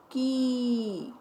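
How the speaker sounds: "की" with the ee held out long; sustained "की" as a phonics model. Shown as a demonstration. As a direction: stretch the vowel in "की", gliding down. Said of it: "आवाज़ लंबी कर कर बोलते हैं".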